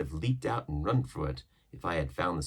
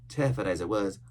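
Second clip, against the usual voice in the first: ordinary delivery, nothing marked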